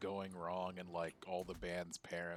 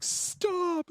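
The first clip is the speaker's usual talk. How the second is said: much higher, louder desperate yell; anguished shout; 14 dB louder than usual. Retold or imitated. Guitarist begs the clown to stop, loud high yell. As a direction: scream for it to end. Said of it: "And it's like".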